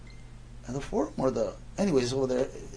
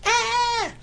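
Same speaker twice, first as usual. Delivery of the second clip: high-pitched short mock scream; imitated scream; 3 dB louder than usual. Roommate reads the visitor's note, imitated scream. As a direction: imitate a friend's startled shriek. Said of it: "he reads the note and he screams".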